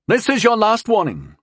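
high, commanding military warning; best military voice. A narrator's reading. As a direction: issue a soldier's final warning. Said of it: "said in his best military voice".

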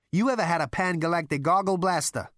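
loud, high challenge; sharp. A narrator's reading. Zaphod challenges Rooster's warning, sharply. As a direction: cut back with a pointed question. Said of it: "asked Zaphod sharply".

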